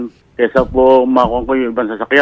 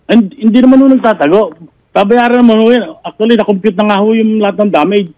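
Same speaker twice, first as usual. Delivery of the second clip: higher-pitched, defensive protest; insistent, raised-voice rebuttal; 5 semitones higher than usual. Real, not acted. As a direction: argue back with the voice pitched up. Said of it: "taas-taasan ng boses itong complainant".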